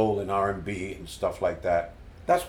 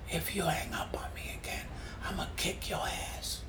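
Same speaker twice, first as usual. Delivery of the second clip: rasped, breathy near-whisper; 7 semitones higher, 13 dB quieter than usual. Retold or imitated. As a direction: imitate a raspy voice on a late-night phone call. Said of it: "That's what he said".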